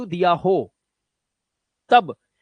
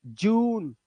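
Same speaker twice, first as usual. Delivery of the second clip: normal talking voice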